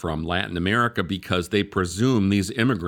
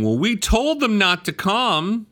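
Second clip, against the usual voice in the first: high, mocking voice of the administration; mocking whiny quote; 11 semitones higher, 3 dB louder than usual. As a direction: squeak it out like a scolded official. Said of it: "the Biden administration is running around saying".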